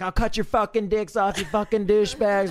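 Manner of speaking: high, loud mock drunk yelling; drunken yelling threat. Drunk woman yelling threats at guys while dragged outside. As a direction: yell it like a furious drunk being dragged out.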